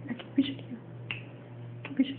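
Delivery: hushed coaxing whisper. Real, not acted. Sussurra ao bebê brincando. (sussurrando baixinho)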